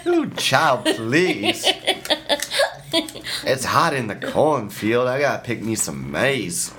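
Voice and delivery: lightly put-on Southern belle drawl; hint of a Southern drawl, playful. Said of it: "Start talking like a Southern belle".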